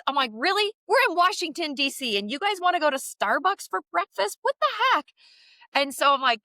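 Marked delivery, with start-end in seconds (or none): 0.3-5.1 high, disbelieving mock outrage; incredulous mom. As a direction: scoff at the idea, amazed. Mother's incredulous reply retold.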